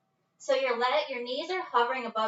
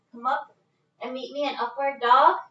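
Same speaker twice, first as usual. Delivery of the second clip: usual speaking voice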